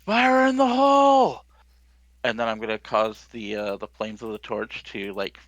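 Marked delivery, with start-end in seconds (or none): 0.0-1.5 booming, much higher and louder battle cry. Acted. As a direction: bellow the warning at full force. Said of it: "booming voice".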